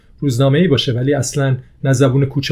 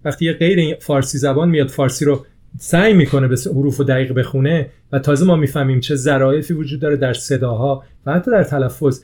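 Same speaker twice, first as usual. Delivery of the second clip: ordinary talk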